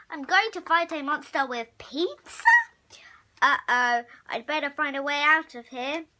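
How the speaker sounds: ordinary delivery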